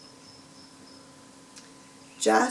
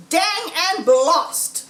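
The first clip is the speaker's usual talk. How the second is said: slow, louder, higher in a character voice; Boggis cursing; 6 semitones higher, 5 dB louder, slower than usual. Acted. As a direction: spit out the curse, annoyed. Farmer Boggis curses.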